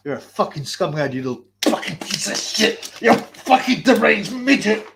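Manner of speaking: mock aggressive tirade. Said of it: "pretended he was like".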